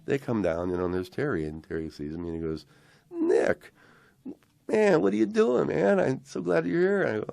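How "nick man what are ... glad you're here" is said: very high, loud, delighted hail; imitated excited musician's welcome; overjoyed greeting. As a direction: greet an old friend across the room, acting him out. Retold bandleader spots old friend.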